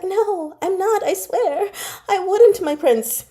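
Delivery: high, pleading protest; pleading denial. Retold or imitated. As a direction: beg him to believe you. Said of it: "Shen Huaishi is like".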